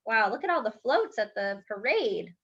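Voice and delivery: ordinary delivery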